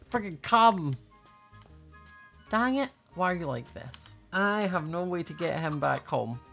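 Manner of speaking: normal talking voice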